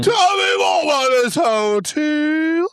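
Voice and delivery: slow, high, booming yell. Real, not acted. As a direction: bellow it out slowly. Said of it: "He just started yelling again".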